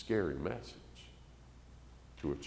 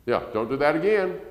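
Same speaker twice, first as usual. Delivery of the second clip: weak parent warning; 7 semitones higher, 5 dB louder than usual. Retold or imitated. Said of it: "the parent's gonna say what".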